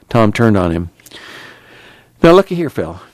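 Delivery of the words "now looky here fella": stern scolding. Acted. Tom scolds the one-eyed man.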